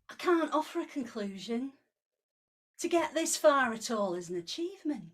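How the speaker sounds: put-on poem read in a lightly done Yorkshire accent; a hint of Yorkshire in every line. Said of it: "this poem is written in a Yorkshire accent".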